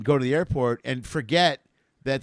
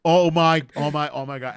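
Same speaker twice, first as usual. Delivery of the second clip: mock embarrassed gasp; 6 dB louder than usual. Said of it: "the woman was like".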